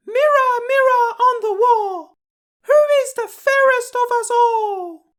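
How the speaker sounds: very high, loud put-on queen voice; a vain queen calling grandly to her mirror; proud queen. Queen asks mirror again.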